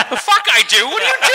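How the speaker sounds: very high, squawked disbelief; hammed-up shriek of bafflement; incredulous outburst. Acting out baffled reaction to vandal.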